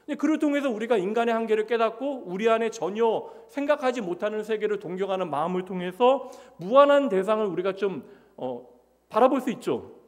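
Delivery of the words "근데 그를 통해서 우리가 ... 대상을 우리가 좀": ordinary delivery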